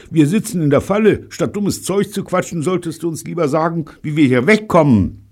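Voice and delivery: high, loud impatient scolding; gruff. Acted. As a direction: tell him off, fed up. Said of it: "unterbrach Bunny barsch".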